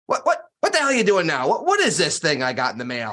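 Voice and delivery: high, sputtering mock curmudgeon at first. Grumpy investor calls about a mailed packet, curmudgeonly. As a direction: imitate a cranky friend demanding answers. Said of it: "little bit curmudgeon-y at times".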